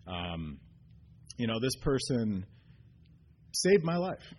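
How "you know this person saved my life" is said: a grateful man fighting back tears; choked up. Real, not acted. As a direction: voice breaking with real emotion, louder and higher. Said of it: "sorry, I'm getting choked up".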